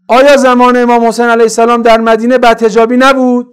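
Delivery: loud, high rhetorical questioning; full-force preaching. Real, not acted. Preacher challenging congregation about Imam Hussein's time, loud preaching. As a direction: fire the challenge at the whole congregation.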